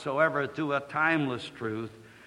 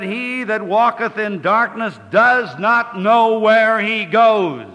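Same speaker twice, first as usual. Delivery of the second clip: loud, slow and higher, thundering the verse; forceful preaching; 6 semitones higher, 9 dB louder, slower than usual. Acted. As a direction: proclaim it to the whole congregation.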